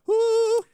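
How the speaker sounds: loud, high, drawn-out "whoo"; belted high song-hook whoop; sung "whoo" hook. Retold or imitated. Imitating the song's hook.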